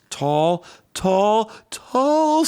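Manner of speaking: straining upward sing-song; stretching tall. Acted. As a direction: stretch your voice up on each tall. Little E stretching up.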